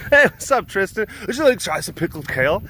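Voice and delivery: high, bright pushy seller; eager market vendor. Pretend. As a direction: pitch the kale eagerly.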